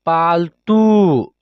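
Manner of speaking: पालतू read slowly with the ऊ held long; stretched long vowel. Shown as a demonstration. Teacher drawing out the long ऊ for the class. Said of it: "खींच कर पढ़ रहे हैं".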